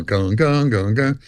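low, ringing string plucks by mouth; imitated guitar tuning. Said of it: "I, am tuning to the piano".

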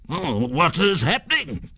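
high, worried ghost voice; alarmed ghost. A narrator's reading. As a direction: ask in rising alarm as a spooked spirit.

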